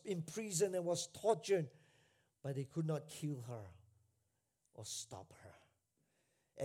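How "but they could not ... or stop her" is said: much lower and softer, slowing to a near-whisper; preacher hushes for the victory; hushed solemn drop. Acted. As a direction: let it sink to a hush.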